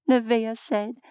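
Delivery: ordinary delivery, nothing marked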